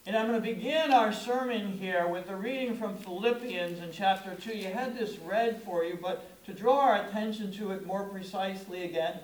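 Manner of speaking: usual speaking voice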